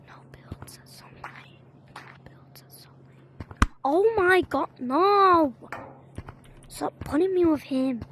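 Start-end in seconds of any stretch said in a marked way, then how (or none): 0.1-1.6 unvoiced whisper. Repeats line in whisper. 1.9-3.7 whispering. Pretend. Repeats line in whisper again.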